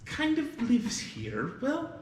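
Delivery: high, loud fussing hostess voice; a motherly innkeeper all in a flap; flustered innkeeper. Acted. Lydia explains the orphan girl to the guests, high and flustered. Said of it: "And Lydia goes".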